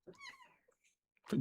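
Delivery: very high, slow, drawn-out coo. Real, not acted. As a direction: ask the cat in a tiny stretched-out voice. Baby talk to the cat.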